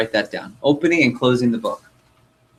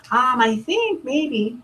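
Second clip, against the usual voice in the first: slow, high, hesitant maybe; unsure customer; 13 semitones higher, much slower than usual. Retold or imitated. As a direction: drag out the doubtful maybe.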